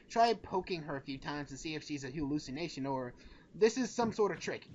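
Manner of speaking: ordinary talk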